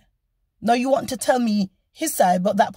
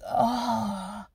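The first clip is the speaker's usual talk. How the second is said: imitated drawn-out "oh"; 2 semitones lower, 7 dB quieter than usual. Police officer reacting to the driver's answer, imitated drawn-out "oh". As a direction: let out a quiet, long "oh", imitating the officer. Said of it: "you know, like that".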